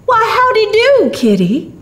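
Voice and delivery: loud high surprised hello; surprised old man. A narrator's reading. Old man greets the lost boy, loudly surprised. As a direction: greet the child with happy surprise. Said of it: "with surprise gleaming".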